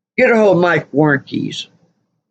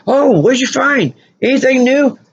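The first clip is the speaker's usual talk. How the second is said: mock eager interviewer; 8 semitones higher than usual. Mock interviewer asking archaeologists.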